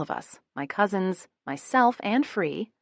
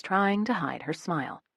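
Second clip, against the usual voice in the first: ordinary delivery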